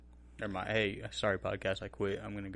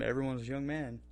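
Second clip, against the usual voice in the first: usual speaking voice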